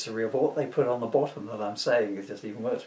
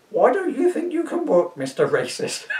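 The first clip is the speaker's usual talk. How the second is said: bureaucrat voice; 12 semitones higher, 8 dB louder than usual. Acted. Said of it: "This bureaucrat asked him".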